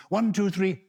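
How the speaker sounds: ordinary talk, nothing marked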